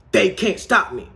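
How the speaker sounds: high, loud defiant boast; cocky shout. Retold or imitated. Retelling a gunman hopping out of the car, cocky shout. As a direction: shout it like an invincible tough guy.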